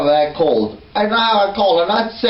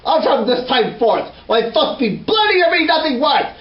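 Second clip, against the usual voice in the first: ordinary talk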